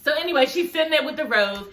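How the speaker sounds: ordinary talk, nothing marked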